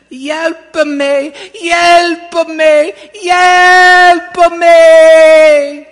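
put-on high, loud drawn-out screams in a child's accented voice, building; cries for help. A narrator's reading. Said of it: "so she started going".

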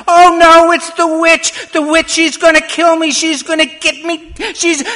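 high, very loud panicked shrieks; terrified screaming. A narrator's reading. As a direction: scream for your life, cut off abruptly. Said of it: "and she started screaming".

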